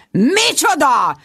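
high, slow, outraged What?; shocked outburst, drawn out; exploding in outrage. Acted. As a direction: burst out in shocked disbelief. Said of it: "robbant Sharon".

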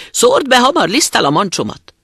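loud, high-pitched urgent command; wolf's urgent order. A narrator's reading. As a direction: order it loudly, in a hurry. Wolf orders the miller. (sürgető, hangos farkasparancs)

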